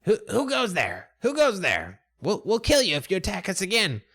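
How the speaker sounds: high, challenging rat voice; high-pitched creature's warning to intruders; shouting rat. Acted. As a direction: call out high like a rat sentry. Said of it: "as it shouts again".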